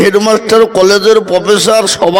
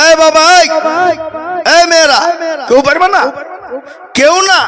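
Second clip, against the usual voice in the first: calling out to class; 7 semitones higher, faster than usual. Acted.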